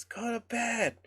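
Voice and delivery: high, soft imitation of a mom calling; mock yell. Storyteller imitating mom yelling at the kids, high hushed mock yell. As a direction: mimic a distant yell without the volume. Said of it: "my mom yelled at us".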